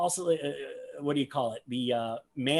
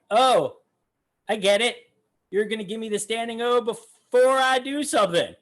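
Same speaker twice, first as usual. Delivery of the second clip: higher mock voice gently teasing the crowd; a raised, amused send-up of a magician mocking his ovation; imitating Mac; 8 semitones higher, 5 dB louder than usual. Commentator mimics the magician teasing the standing crowd, higher playful mock voice. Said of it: "and being like".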